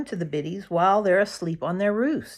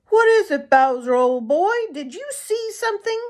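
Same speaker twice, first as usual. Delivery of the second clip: put-on high, loud eager boy's voice; a boy calling out to his excited hound; boy calling his dog; 12 semitones higher, 5 dB louder than usual.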